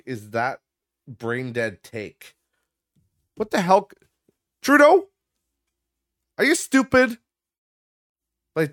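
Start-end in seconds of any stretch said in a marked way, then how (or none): 3.4-7.2 loud, high, incredulous yell; shouting at the prime minister in outrage; outraged yell. Real, not acted. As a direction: blow up at him in disbelief. Outraged rant at Trudeau.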